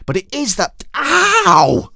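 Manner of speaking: put-on very high, loud girl's yelps of ow; girl yelping at her brother, very high; Ketchup Face protesting. A narrator's reading. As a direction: protest while being jabbed in the ribs. Girl jabbed again.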